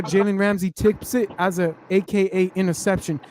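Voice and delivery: ordinary talk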